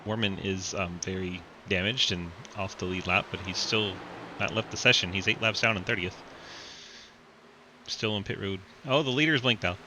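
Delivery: race commentary. Acted. Live race play-by-play.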